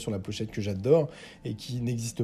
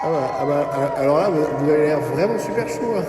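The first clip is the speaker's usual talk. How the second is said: hype host; 7 dB louder than usual. Acted. Host talking to crowd.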